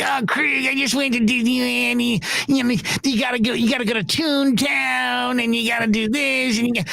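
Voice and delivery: high-voiced impression of a gushing tipster; Ralph. Colleague pushing Disneyland tips, in a high impersonated voice. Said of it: "He's like".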